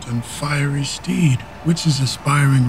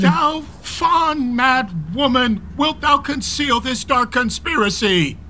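angry rebuke; 8 semitones higher than usual. Acted. Husband rebuking his wife for shielding their son, high and angry. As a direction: berate her for hiding the plot.

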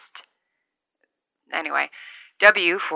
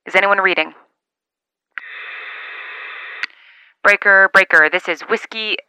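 loud CB radio call; over a radio; 5 dB louder than usual. Acted. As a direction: hail the channel, projecting. Calling out on CB radio.

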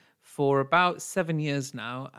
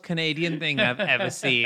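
usual speaking voice

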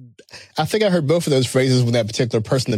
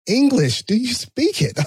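mock tough quote; 11 semitones higher, slower than usual. Recalls Pulp Fiction-style line.

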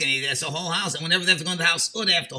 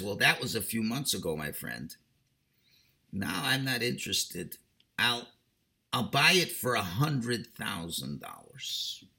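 smug lowball; 6 semitones lower, 7 dB quieter than usual. Acted.

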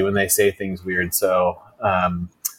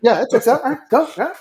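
squeaky high mock voice, higher; high silly echo; 14 semitones higher, 3 dB louder, much faster than usual.

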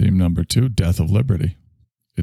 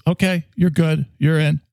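casting director; 10 semitones higher than usual. Pretend. Casting director hires applicant.

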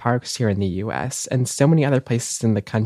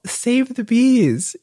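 put-on childlike cheer; 10 semitones higher, much slower than usual. Reading out a child's answer.

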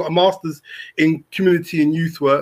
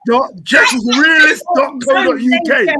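loud announcer-style call-out; mock shouted web address; 6 semitones higher, 6 dB louder than usual. Teasing the co-host with a fake website: loud mock announcement. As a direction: belt it out like an advert plug.

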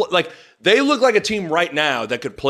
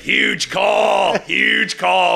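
imitating a radio announcer; 4 semitones higher, much slower than usual. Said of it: "And then Matt Davis is like".

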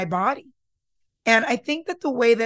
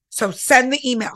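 louder, urging yourself on; pep talk push; 4 semitones higher, 6 dB louder than usual. Retold or imitated. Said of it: "And then I go".

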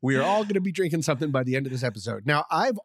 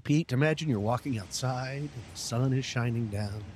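guided meditation; 4 semitones lower, 4 dB quieter than usual. Acted. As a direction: lull him into calm, softly. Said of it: "one of my famous guided meditations".

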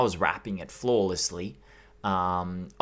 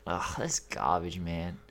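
disgusted; 5 semitones lower, 9 dB quieter, slower than usual. Real, not acted. Disgusted dismissal.

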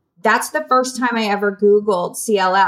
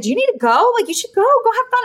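mom urging; 11 semitones higher, faster than usual. Retold or imitated. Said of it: "My mom's like".

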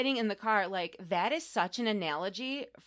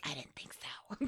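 whispered snark; 8 semitones lower, 14 dB quieter than usual. Retold or imitated. Snarky aside about the woman.

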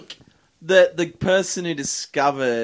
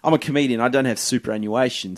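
ordinary delivery

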